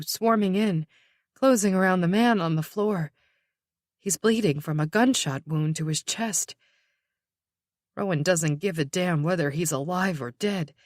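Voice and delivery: ordinary delivery, nothing marked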